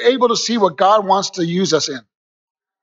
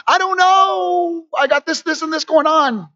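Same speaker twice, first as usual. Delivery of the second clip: whiny overwhelmed answer; 8 semitones higher than usual. Acted. People answer in his story.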